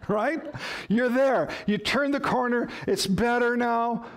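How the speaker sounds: normal talking voice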